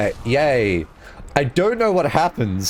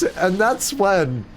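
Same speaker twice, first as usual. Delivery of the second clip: usual speaking voice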